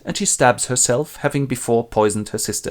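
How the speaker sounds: normal talking voice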